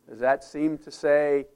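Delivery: ordinary talk, nothing marked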